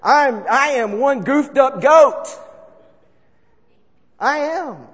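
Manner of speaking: loud, high, slow declaration; preacher confesses his brokenness to the crowd, loud and slow; emphatic confession. Real, not acted. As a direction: proclaim it with full conviction. Emphatic self-confession in sermon.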